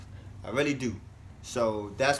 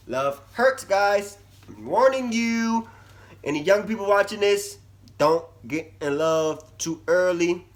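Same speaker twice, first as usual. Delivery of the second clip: earnest warning; 6 semitones higher, 6 dB louder than usual. Real, not acted. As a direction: call out the warning like a big sibling. Earnest warning to young viewers.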